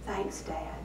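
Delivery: a hushed, grateful thank-you breathed out; soft thanks. A narrator's reading. Rebecca quietly thanking her dad while embracing him, hushed. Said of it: "Rebecca whispered".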